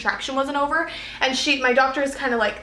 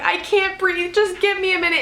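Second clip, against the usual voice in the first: high, tearful pleading for a break; retold crying plea; 7 semitones higher than usual. Exhausted mother in labor begs her doctor for a minute, crying. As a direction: beg for a minute through tears. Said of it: "And so I'm crying".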